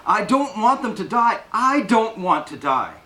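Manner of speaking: put-on high, child-like refusal, slowing on the last line; stamping defiance. A narrator's reading. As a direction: dig in like a stubborn child. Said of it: "Blanche stamps her foot".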